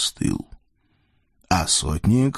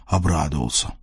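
usual speaking voice

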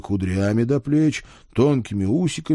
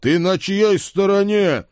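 higher, slow indignant demand; angry demand; 10 semitones higher, 5 dB louder than usual. Acted. Father demanding whose side Ed is on, slow and indignant. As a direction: grab him by the ear and demand.

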